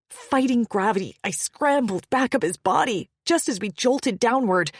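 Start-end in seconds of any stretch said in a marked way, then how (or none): none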